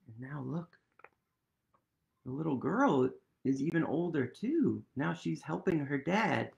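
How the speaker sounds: plain reading voice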